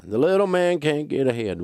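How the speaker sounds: high, exaggerated whining complaint; mock whine. Mimicking people who say they can't get ahead, whining. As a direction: moan it in a put-on whiny voice. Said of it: "they always whine when they're saying it".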